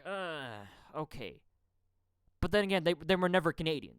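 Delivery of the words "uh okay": grudging acceptance. Retold or imitated. Conceding they may be newcomers, grudging and quiet. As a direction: give in quietly with a grudging groan. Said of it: "And I'd be like".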